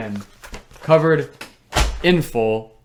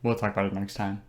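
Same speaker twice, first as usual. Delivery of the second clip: low, soft deferral; low-key brush-off; 3 semitones lower, 8 dB quieter than usual. Real, not acted. Host putting off the cohost's actor question, low-key brush-off. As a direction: drop it quietly and move along.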